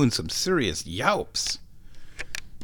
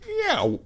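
very high strangled meow mid-yawn; high imitated cat yawn-meow; yawning meow imitation. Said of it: "and it comes out".

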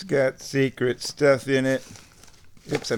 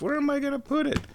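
high exasperated question; 4 dB quieter, much faster than usual. Real, not acted. Exasperated question about space.

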